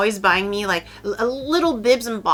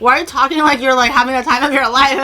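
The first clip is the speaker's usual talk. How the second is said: higher, louder volley of questions; alarmed parents; 4 semitones higher, 5 dB louder than usual. Retold or imitated. Parents question suddenly lively daughter, mock alarmed. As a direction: imitate baffled parents piling on questions. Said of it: "if I'm speaking like this, you're gonna think I'm on drugs".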